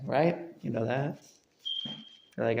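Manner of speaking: ordinary delivery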